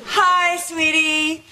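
high, bright, hammed-up 1950s TV housewife; exaggerated sitcom mom. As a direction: gush like a perfect TV mom. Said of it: "are you sure you want it that exaggerated".

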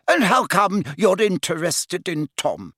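high-pitched probing question; inquisitive matron. A narrator's reading. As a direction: press the visitor as a matron.

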